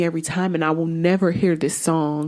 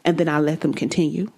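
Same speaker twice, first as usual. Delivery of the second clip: normal talking voice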